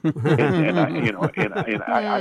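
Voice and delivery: words pushed out through a high laugh; real laughter spilling over the words. Real, not acted. Laughs at Mary being heavy.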